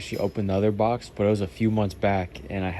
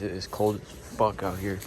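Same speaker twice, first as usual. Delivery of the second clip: usual speaking voice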